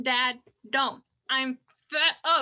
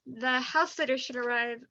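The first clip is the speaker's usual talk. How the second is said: usual speaking voice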